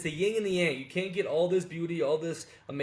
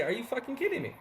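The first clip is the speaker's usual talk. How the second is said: much higher, disbelieving rebuke; incredulous anger; 7 semitones higher than usual. Retold or imitated. Expat planning to scold the late old tailor, higher and fed up. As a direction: confront him, unable to believe it. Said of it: "look at this old man and say".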